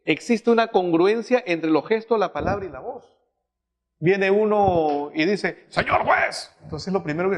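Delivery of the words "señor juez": "Señor juez" said with a rising, irritated edge; a touch of sullen annoyance on "Señor juez"; annoyed address. Shown as a demonstration. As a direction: greet the judge like a man dragged here against his will.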